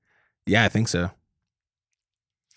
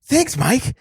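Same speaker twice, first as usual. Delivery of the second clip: higher, hammed-up complaining; mock entitled star; 5 semitones higher than usual. He plays the star griping about a warm drink. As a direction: whine like a pampered celebrity. Playing Wahlberg.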